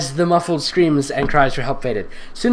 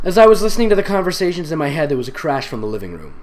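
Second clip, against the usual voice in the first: normal talking voice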